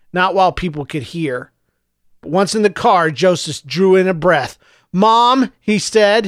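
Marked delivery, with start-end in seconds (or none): 4.9-5.5 shaking with emotion. Acted. Boy to his mother, voice shaking with emotion. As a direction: voice trembling, higher and louder. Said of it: "his voice shaking with emotion".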